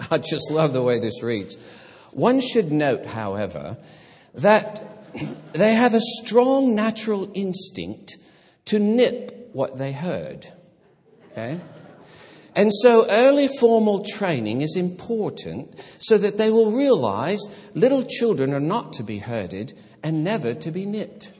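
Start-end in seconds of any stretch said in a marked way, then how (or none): 2.1-10.5 higher and louder, in a self-confessed bad English accent; hammed-up posh English reading voice, amused; mock English accent. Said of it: "my English accent is, is very bad". 12.5-21.3 higher and louder, in a self-confessed bad English accent; hammed-up posh English reading voice, amused; mock English accent. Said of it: "It just sounds like an English person wrote that".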